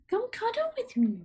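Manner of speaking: sweet squeaky pet voice; high pet coaxing. Real, not acted. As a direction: coax the kitty over in a tiny high voice. Coaxing her cat to cuddle.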